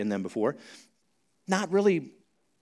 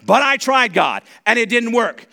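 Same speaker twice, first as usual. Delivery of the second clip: raised, lightly acted protest; louder, higher imitated complaint; mild mock complaint; 7 semitones higher, 7 dB louder than usual. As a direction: protest to God like a frustrated believer. Voices a complaining believer.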